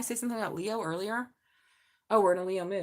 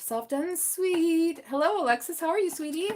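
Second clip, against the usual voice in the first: sweet voice; 8 semitones higher than usual. Acted. Reading card words sweetly.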